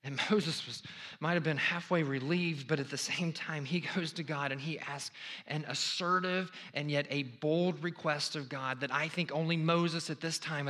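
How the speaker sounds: ordinary delivery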